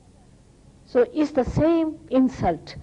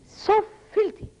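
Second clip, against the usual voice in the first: disgusted repeat; 12 semitones higher than usual. Real, not acted.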